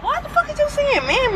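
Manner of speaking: high-pitched outburst. Real, not acted. Heated argument with cousin.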